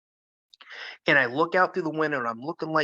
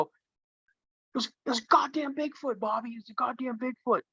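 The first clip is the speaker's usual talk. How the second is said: high, softer urgent hiss of fear; hushed panic; 10 semitones higher, 4 dB quieter than usual. Retold or imitated. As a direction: tell him in a terrified hush. Whispering scared to brother.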